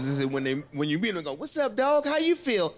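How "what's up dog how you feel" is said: high, eager cheesy greeting. Retold or imitated. Friends describe a Virgo's greeting, high and goofy. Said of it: "friends who told you about Virgos".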